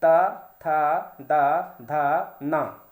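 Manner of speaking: ता, था, दा, धा, ना spaced out very slowly; slow drill. Shown as a demonstration. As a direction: model each dental sound slowly for repeating. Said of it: "उच्चारण करके देख सकते हैं मेरे साथ".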